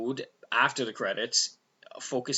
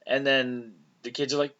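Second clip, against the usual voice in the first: ordinary delivery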